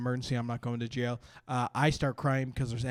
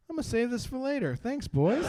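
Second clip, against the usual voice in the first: higher, pleased cop voice; cop's voice; 8 semitones higher than usual. Retold or imitated. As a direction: pitch it up with relish. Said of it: "picks it up and goes".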